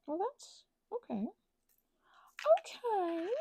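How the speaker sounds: ordinary delivery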